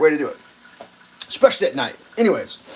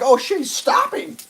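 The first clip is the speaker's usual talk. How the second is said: startled realization; 12 semitones higher than usual. Retold or imitated. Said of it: "It's like".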